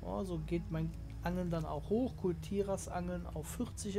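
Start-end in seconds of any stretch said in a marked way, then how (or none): none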